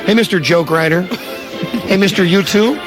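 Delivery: ordinary talk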